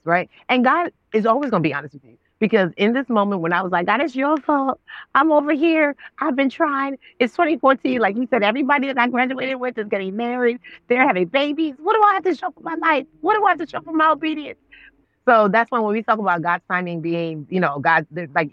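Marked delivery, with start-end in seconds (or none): 3.8-14.6 high-pitched, exasperated grievance to God; retold angry complaint. Woman retelling her complaint to God about her life, exasperated. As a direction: plead your case in a raised pitch, without shouting. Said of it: "I'm yelling at God".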